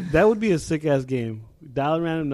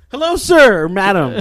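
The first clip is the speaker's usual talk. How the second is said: salesman voice, pitched up and loud; chirpy cold caller, much higher and louder; high, loud telemarketer greeting; 6 semitones higher, 10 dB louder than usual. Pretend. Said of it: "contest cold call".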